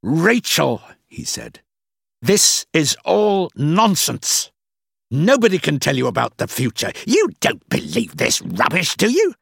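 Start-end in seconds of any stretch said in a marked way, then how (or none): 0.0-0.8 high, loud, exasperated name-call; growing anger. A narrator's reading. Boss getting angrier at Rachel, exasperated. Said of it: "He got angrier and angrier". 2.2-9.3 growing anger. A narrator's reading. Boss rubbishing fortune-telling to Rachel, angry. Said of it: "He got angrier and angrier".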